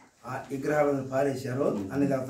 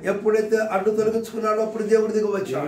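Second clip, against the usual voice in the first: strong, ringing calls that drive home the point; fiery preaching; 5 semitones higher, 5 dB louder than usual. Acted. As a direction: preach it out to the crowd. Preacher calls out, congregation answers.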